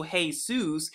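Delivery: "Jesus" with an H start and soos ending; pronounced hay-SOOS. Shown as a demonstration. Teacher giving an alternative pronunciation, "Jesus" said hay-soos. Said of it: "you can also pronounce this as".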